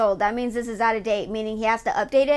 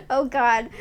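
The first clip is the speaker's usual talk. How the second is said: ordinary talk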